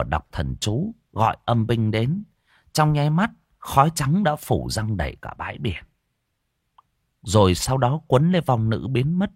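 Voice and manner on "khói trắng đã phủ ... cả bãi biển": plain reading voice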